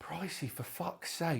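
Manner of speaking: slow, whispered despair; exasperated whisper. Real, not acted. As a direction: whisper it, worn out by the argument. Muttered exasperation at friend.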